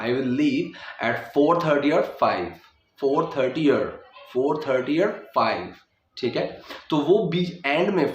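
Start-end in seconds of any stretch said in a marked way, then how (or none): 0.0-5.7 or reduced to a weak er linked to thirty; slow, let or melt into thirty; unstressed or. Shown as a demonstration. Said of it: "वो जो sound करेगा". (कमज़ोर अर वाला or)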